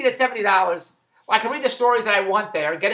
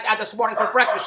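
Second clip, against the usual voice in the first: ordinary talk, nothing marked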